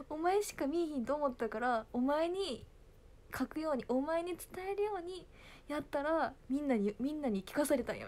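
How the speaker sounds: high, aggrieved mock pleading; dad's excuse. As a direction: complain like a father who was caught out. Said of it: "パパはいまだにいじってる". (弁解する父親の関西弁)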